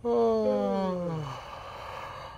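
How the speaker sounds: long yawn with a falling sigh. Shown as a demonstration. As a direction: open wide and sigh out a yawn. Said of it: "just to yawn".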